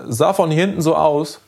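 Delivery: put-on high-pitched boy making an excuse; boy's hasty lie. A narrator's reading. Boy lying to his angry father, high hasty excuse. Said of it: "log Lenny schnell".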